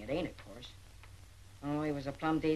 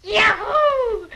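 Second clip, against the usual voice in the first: joyful whoop; 12 dB louder than usual. Acted. Boy overjoyed at offer.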